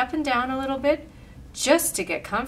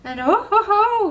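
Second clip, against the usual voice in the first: high, loud storybook Santa chuckle; rising, booming ho-ho-ho; Santa's ho-ho-ho; 11 semitones higher, 8 dB louder than usual. A narrator's reading. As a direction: belt out a jolly Santa laugh in words. Santa's laugh, read in story.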